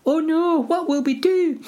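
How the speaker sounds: very high falsetto wail; helpless hobbit. Acted. Hobbits carried off by orcs, high mock wail. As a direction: squeak it in mock distress.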